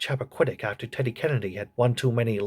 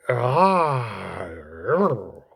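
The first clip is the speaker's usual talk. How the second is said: creature grunt; 3 semitones lower than usual. Acted. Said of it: "You're right, Agnew".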